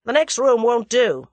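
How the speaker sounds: forceful American interruption; interrupting. A narrator's reading. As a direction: cut in firmly and overrule. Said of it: "interrupted Julius".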